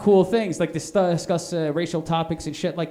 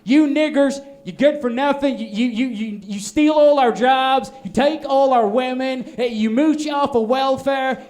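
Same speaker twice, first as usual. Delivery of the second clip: higher, louder bigot voice; 6 semitones higher, 4 dB louder than usual. Retold or imitated. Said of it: "They walk up and say".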